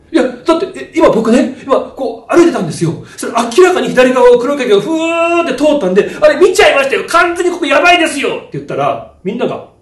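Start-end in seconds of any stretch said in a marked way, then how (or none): 0.1-8.4 louder, higher, urgent telling; agitated explaining. Retold or imitated. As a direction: insist you really saw it. Excitedly explaining the shadow.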